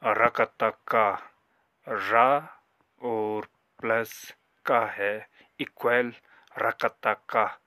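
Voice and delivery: syllable by syllable: रकता का, slowly and loudly; slow demo reading. Shown as a demonstration. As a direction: spell each part out clearly to the class. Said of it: "आज मैं जो रंगो है उसको पढ़ने जा रहा हूँ". (धीमे और ज़ोर से अक्षर पढ़कर दिखाना)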